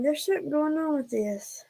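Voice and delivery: normal talking voice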